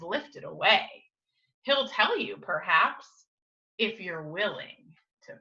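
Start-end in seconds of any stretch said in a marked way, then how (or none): none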